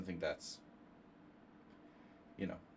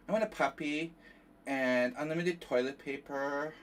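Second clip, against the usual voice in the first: put-on higher, whiny child voice; mock childish whine; 6 semitones higher, 4 dB louder than usual. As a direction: whine it like a kid. Reads a chat wish in mock voice.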